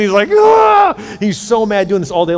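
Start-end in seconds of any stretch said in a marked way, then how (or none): 0.3-0.9 high, loud yell of frustration; hammed-up roar of rage; angry scream. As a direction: explode in a wordless high cry. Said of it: "he's so angry doing".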